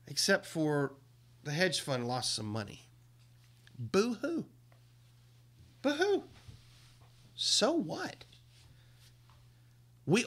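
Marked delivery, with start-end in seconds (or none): 3.8-4.4 mocking boo-hoo, higher, a put-on sniffle; mock sob. Vlogger mocking the hedge fund's losses with a fake sob. 5.8-6.2 a much higher, squeaky mock boo-hoo; mock sob higher. Vlogger sneering at hedge fund losses, squeaky mock sob.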